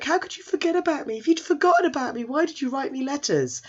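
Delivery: high-pitched mock heartbreak; wounded accusation. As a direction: plead like a jilted lover, hammed up. Hurt boy confronts the man.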